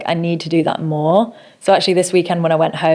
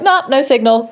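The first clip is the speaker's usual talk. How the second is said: higher-pitched mock "nah"; dismissive quote; 8 semitones higher than usual. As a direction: report the letdown in a raised voice. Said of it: "and be like".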